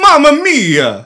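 hammed-up Italian accent, high, slow and loud; over-the-top Italian accent exclamation. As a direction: stretch out a cartoon Italian accent. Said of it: "if Chris Pratt has to do the Italian accent".

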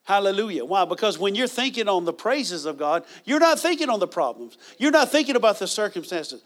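ordinary delivery

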